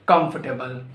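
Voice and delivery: "comfortable" as KUMF-tuh-bul; corrected COMFORTABLE. Shown as a demonstration. Teacher correcting learners, "comfortable" said as KUMF-tuh-bul. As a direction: say "comfortable" with no R in the middle. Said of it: "No, it's not comfortable".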